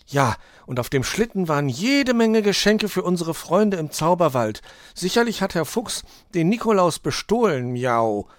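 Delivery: high, loud cat character ending on a miaow; Mauz reporting. Acted. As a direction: report a theft as an excited little cat.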